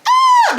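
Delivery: very high, loud sustained whoop; playful high squeal. Pretend. Woman celebrating her step back, playful squeal. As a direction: let out a playful high-pitched holler.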